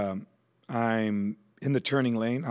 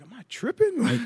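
incredulous; 12 semitones higher than usual. Retold or imitated. Disbelief at hearing his name.

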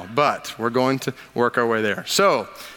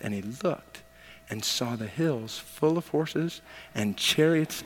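hushed storytelling; 4 semitones lower, 7 dB quieter than usual. Acted. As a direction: draw them in quietly to the vision. Hushed reverent retelling of vision.

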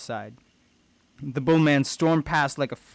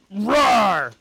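loud, higher acted roar; monster roar; 8 semitones higher, 9 dB louder than usual. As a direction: roar out the word like a beast. Monster roars back.

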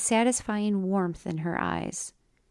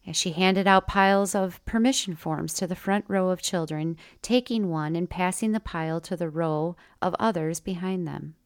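usual speaking voice

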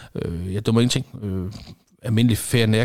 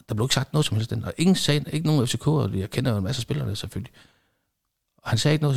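ordinary talk

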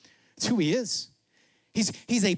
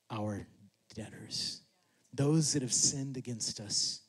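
soft, low, hushed sermon close; intimate preaching; 6 semitones lower, 7 dB quieter than usual. Real, not acted. Preacher urging forgiveness, hushed, low and intimate. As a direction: draw the room in quietly.

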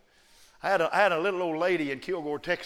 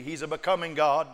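ordinary talk, nothing marked

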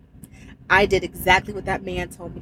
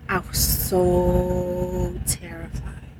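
"so" stretched very long and slow; drawn-out SO; 3 semitones lower, 4 dB quieter, much slower than usual. Real, not acted. Trucker telling viewers of her fear, drawn-out "so". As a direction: hold the word "so" for a long beat.

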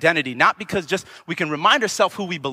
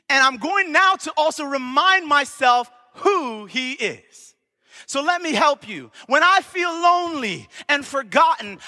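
high, ringing sermon delivery with drawn-out key words; full-force preaching; 8 semitones higher than usual. Real, not acted. Preacher reminding the congregation who God is, full-force preaching. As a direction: proclaim it over the congregation, pitched high and sustained.

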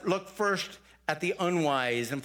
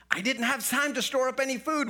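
whining grasshopper; 8 semitones higher than usual. Acted. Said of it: "whined the grasshopper".

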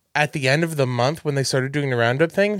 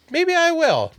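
high sing-song; slower than usual. Retold or imitated. Retold own hopeful reply.

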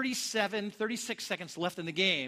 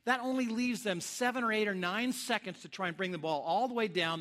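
normal talking voice

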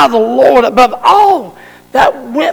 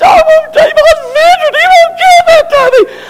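very high, crying whine of a grown man; whining toddler; 3 dB louder than usual. Acted. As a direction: wail it like a spoiled two-year-old. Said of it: "whining like a two-year-old".

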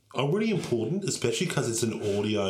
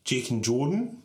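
suspenseful awards presenter. Acted. Reads nominations at awards.

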